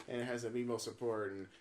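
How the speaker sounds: ordinary delivery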